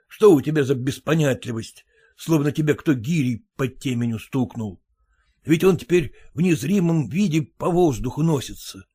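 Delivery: usual speaking voice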